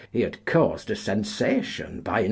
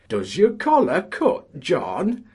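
much higher, louder mocking taunt; sarcastic jeer; 12 semitones higher, 7 dB louder than usual. A collier mocks the dandy choirmaster, high and jeering. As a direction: needle him from the crowd. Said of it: "asked a sarcastic voice".

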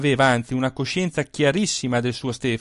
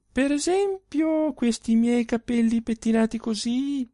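teasing; 9 semitones higher than usual. A narrator's reading. She teases with her answer.

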